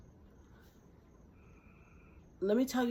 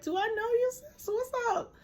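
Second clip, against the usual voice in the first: very high, amused puzzled greeting, acted out; chuckling puzzlement. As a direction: politely feel out a half-known face, voice way up. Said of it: "had this little chuckle on my face".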